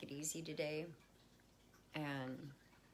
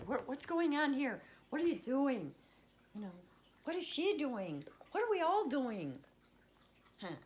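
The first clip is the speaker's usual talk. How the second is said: high, louder playful fussing; mock nosy alarm; 11 semitones higher, 5 dB louder than usual. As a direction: play the busybody checking on the cats. Playfully talking to her cats.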